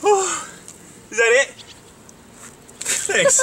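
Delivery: gasping from the cold; catching breath after icy drenching; in ice cold water. Real, not acted. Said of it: "that's just ice cold".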